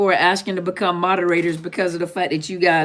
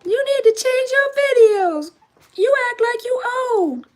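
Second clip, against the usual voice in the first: much higher made-up voice for a scolding friend; made-up Tasha voice. Retold or imitated. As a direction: squeak it way up like a nagging old friend. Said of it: "what Tasha's voice looked like".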